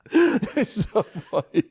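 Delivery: laughing. Real, not acted. Laughing at the joke.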